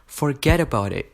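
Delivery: sharp rising "what?", scandalised and dismissive; incredulous dad. Retold or imitated. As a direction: imitate a father outraged by the news. Dad's shocked rejection, acted out.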